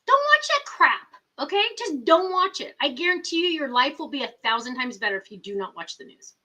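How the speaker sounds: high, insistent telling-off; scolding niece. Retold or imitated. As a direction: firmly tell your aunt to stop. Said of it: "And I'm like".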